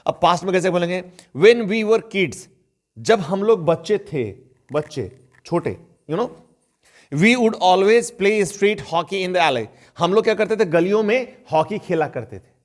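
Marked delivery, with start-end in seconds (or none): 1.4-2.4 slow example reading. Shown as a demonstration. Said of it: "teacher reads example slowly". 7.1-9.7 very slow, careful English example sentence; slow example read. Shown as a demonstration. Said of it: "teacher reads example slowly".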